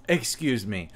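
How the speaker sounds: normal talking voice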